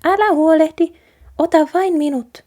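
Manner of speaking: a tiny mouse boasting she can keep house; eager mouse reassuring the boy; plucky little mouse. A narrator's reading. Mouse insisting.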